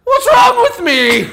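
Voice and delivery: high, indignant Irish lilt; Irish accent. Acted. Irishman reading his list of struggles, indignant Irish accent. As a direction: climb into a comic Irish wail. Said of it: "that's a pretty good accent".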